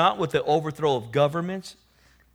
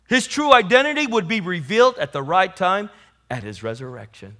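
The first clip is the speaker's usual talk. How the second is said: usual speaking voice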